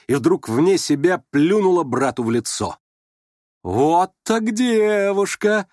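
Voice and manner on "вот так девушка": high, long drawn-out exclamation; admiring shout. A narrator's reading. Nastasya Filippovna applauding Varya's defiance, admiring cry. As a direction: crow it slowly in delight. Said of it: "крикнула Настасья Филипповна".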